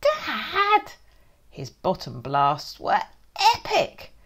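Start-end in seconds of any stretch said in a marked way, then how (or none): 0.0-0.9 put-on high child yelling for dad; child's cry. Child shouting across the house to dad. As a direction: call out to dad, high and loud.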